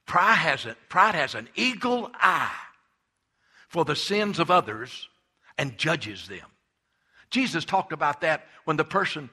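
loud, high fervent pulpit preaching. Real, not acted. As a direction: preach it out, building with fire. Preaching hard against pride.

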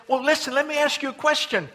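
an exasperated ruler begging the crowd for an answer; Pilate pleading. Retold or imitated. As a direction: Pilate asks the mob what to do with Jesus, high and loud. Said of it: "and then Pilate".

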